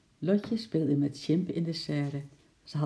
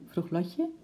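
ordinary delivery

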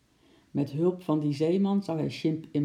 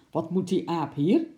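mildly stern conductor; stern question; 3 dB louder than usual. A narrator's reading. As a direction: demand it with a touch of authority. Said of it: "vroeg hij streng". (licht strenge vraag)

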